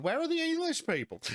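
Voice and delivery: high-pitched mimicked complaint; mock complaint. Ukrainians complaining about England, imitated in a high mock complaint. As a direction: whine it way up high, mockingly. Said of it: "that was their complaint in England".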